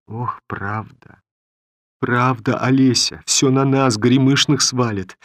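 a lightly voiced old grandmother, lamenting; old woman lamenting. A narrator's reading. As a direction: sigh it like a weary old peasant woman.

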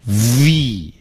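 "V" with buzzing v and drawn-out ee; long, clearly buzzed "V"; teeth-on-lip V. Shown as a demonstration. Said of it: "一定要上牙咬下唇".

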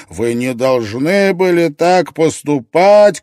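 loud, high, drawn-out cry of reproach; anguished shout. A narrator's reading. Gref accuses Ott while advancing with rifle, anguished shout. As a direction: shout the accusation slowly at full force. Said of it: "кричал Греф".

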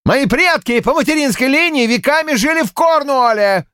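high, fervent proud yell; chest-thumping shout. A narrator's reading. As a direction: pound your chest as you shout. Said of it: "бил себя кулаком в грудь".

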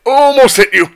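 high, loud startled cry; startled yell. Real, not acted. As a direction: yell it on the near miss. Near miss in firefight.

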